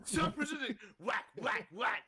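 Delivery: high-pitched play-acted cop shout with whacks; mock police yell. Storyteller acts out police beating him, mock yell. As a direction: punch out each whack like a blow.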